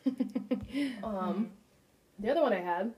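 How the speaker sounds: talking with food in mouth; words round a mouthful; mouth full. Real, not acted. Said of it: "sorry, I have a fish in my mouth".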